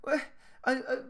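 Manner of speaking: put-on high, halting child's excuse; defensive child. Child asked why he was hit, halting excuse. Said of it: "will they often say".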